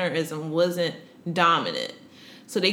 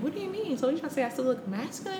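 high, fast, soft rush of disbelief; inner shock; 7 semitones higher, 6 dB quieter, faster than usual. Retold or imitated. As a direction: think it aloud, thrown and quick. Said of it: "I'm like, in my head".

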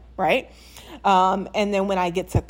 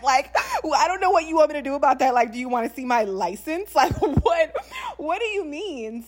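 higher, laughing, incredulous retort; laughing disbelief; 8 semitones higher than usual. Retold or imitated. Retelling her reply to people doubting her hometown, laughing and higher. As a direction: laugh through it, half amused half fed up. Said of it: "And I'm like".

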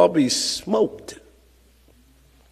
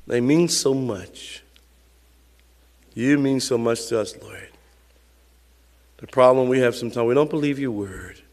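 soft, low pastoral prayer; 6 semitones lower, 5 dB quieter than usual. Real, not acted. Pastor leading the church in closing prayer, softly. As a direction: pray quietly and earnestly to the Father.

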